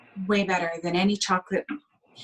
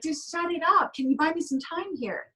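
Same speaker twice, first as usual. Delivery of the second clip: much higher, pleading frazzled parent; mother stuffing a sucker in a crying baby's mouth, exasperated and higher; exasperated mom; 8 semitones higher than usual. Retold or imitated. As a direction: beg for a moment's peace.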